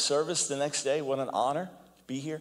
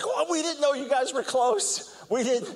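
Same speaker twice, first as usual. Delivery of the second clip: mock surprise; 7 semitones higher than usual. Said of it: "the critics and the attackers are like".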